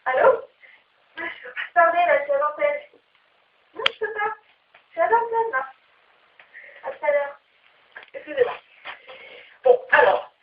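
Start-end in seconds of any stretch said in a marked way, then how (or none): 0.0-8.5 hushed voice into the phone; whispering on the phone. Pretend. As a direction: murmur so the studio can't hear. Répond au téléphone en direct. (chuchoter au téléphone)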